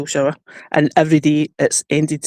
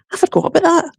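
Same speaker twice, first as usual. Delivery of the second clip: surprised; 10 semitones higher than usual. Retold or imitated. Recalling the collar, quoted self.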